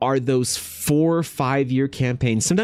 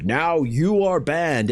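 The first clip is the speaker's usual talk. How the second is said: slow, higher, drawn-out mock verdict; mock big-deal announcement; 5 semitones higher, much slower than usual. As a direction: make a big, ponderous show of it. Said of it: "making a big deal of".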